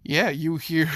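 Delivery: normal talking voice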